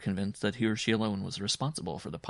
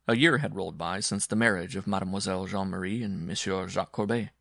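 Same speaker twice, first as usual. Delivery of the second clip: usual speaking voice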